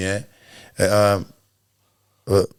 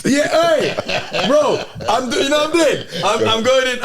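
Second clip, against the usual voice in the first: higher yardie voice, lightly done; Jamaican accent; 7 semitones higher than usual. Retold or imitated. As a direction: slip into a playful yardie voice. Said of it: "I'm doing the yardie thing".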